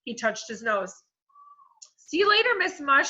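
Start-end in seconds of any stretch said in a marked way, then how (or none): 2.0-3.1 put-on much higher, loud boy's goodbye; Eric Ovens' voice. A narrator's reading. Mister Gorf uses Eric's voice to say goodbye. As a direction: mimic the boy cheerfully. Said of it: "said the voice of Eric Ovens".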